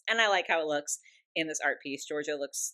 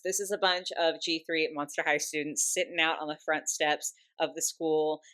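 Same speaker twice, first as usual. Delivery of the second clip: normal talking voice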